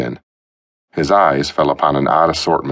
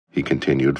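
plain reading voice

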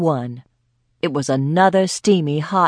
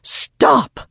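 high bellowed command; old woman bellowing at fighting dogs; 10 semitones higher, 3 dB louder than usual. A narrator's reading. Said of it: "Myrtle bellowed".

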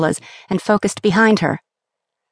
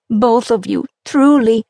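slow, a touch of happy tears; moved, slowly blessing them; tearful mother; 5 semitones higher, 3 dB louder, slower than usual. A narrator's reading. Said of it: "began weeping at the sight".

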